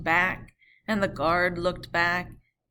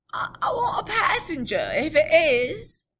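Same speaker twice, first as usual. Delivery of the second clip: much higher stammering voice; a high, halting reply; rider voice; 11 semitones higher, 8 dB louder than usual. Acted. Rider from the mist asking for a passenger, high.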